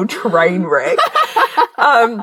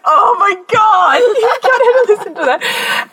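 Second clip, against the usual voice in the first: high, drawn-out dismay; retold horror, pitched way up and stretched out; mock horror; 12 semitones higher, slower than usual. Daughter appalled that auntie heard that episode, high mock horror.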